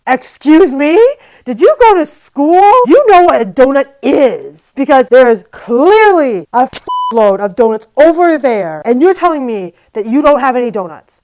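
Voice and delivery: loud, high, incredulous outburst; indignant rant. Retold or imitated. Telling off the cashier who denied the donuts, loud and furious. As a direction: tear into her like she's an idiot.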